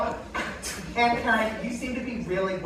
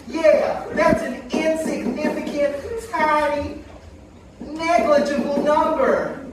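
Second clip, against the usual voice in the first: dismissive dummy voice, raised pitch; Aunt Karen puppet voice; 6 semitones higher than usual. Acted. Dummy aunt brushing off the death rate, scoffing.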